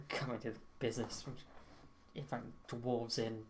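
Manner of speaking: soft, low-key grumble; quiet muttered complaint while leaving; grumbling to himself. Acted. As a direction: mutter away under your breath. Departing character grumbles to himself.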